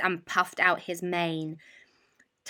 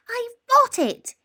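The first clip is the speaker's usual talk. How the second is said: 1 squeaky mouse; 6 dB louder than usual. A narrator's reading. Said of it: "he said".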